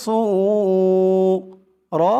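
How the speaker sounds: صورة drawn out very long on one held tone; Quran recitation. Shown as a demonstration. Teacher reciting slowly for the class, drawn-out chant. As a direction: hold the word like a chant.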